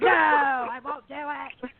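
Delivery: high, drawn-out comic refusal; playfully overdone high-pitched protest; mock protest. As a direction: wail it like a stubborn holdout. Acting out her refusal to healer.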